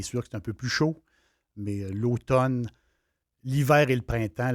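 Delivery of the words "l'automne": normal talking voice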